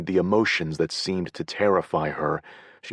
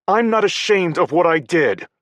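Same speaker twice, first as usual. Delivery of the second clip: higher, louder sharp retort; snapping; 13 semitones higher, 8 dB louder than usual. A narrator's reading. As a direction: bite the words out in defiance. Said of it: "she snapped".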